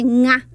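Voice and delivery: nga with a velar ng; demonstrating the NH sound; nasal sound. Shown as a demonstration. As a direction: say nga from the back of the throat. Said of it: "es la N H".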